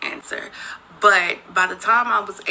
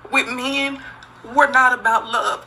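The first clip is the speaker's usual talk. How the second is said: normal talking voice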